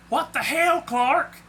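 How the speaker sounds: high, loud angry demand. A narrator's reading. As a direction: confront him with an outraged question. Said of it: "he asked angrily".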